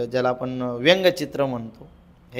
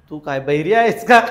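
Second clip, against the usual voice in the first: 1 teasing laugh; 7 dB louder than usual. Acted. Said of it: "समजा आपण म्हटलं".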